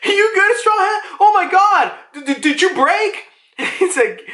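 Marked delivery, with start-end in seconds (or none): 0.0-3.2 worried giants. Retold or imitated. Giants checking on Straw Hat, high fretful voices. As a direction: pitch it up like a cartoon giant. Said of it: "Dory and Bragi are like".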